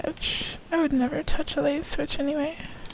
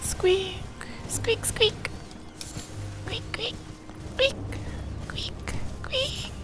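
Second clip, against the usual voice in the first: high breathy squeaks; whispered high rat noises; 7 semitones higher, 5 dB quieter, slower than usual. Pretend. Imitating rats.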